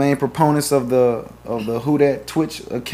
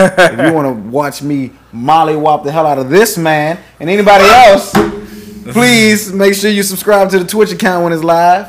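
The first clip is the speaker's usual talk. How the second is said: hype plug; 4 semitones higher, 6 dB louder than usual. Real, not acted. Hyping the Twitch fight.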